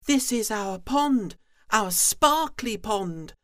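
high, loud little froglets; froglets calling. Acted. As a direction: call out like tiny froglets. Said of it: "the froglets call out".

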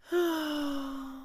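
long high sigh; high creature sigh; tiger sigh. Acted. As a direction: let out a weary sigh. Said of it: "and sighed".

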